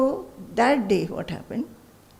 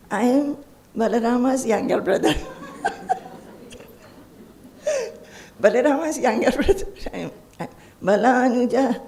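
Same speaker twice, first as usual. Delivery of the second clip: laughing as Krishna; 3 semitones higher, faster than usual. Retold or imitated. Child Krishna answering, laughing.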